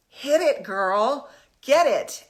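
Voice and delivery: loud, high, fired-up self-hype; pumped-up cheer. Retold or imitated. After a kettlebell workout, cheering herself on, loud and excited. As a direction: shout it like you just crushed a workout. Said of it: "I went from being kinda grumpy".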